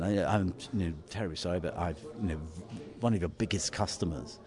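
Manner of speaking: put-on softly apologetic in polite English accent; best English accent. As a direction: plead quietly in your best English accent. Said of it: "the best English accent".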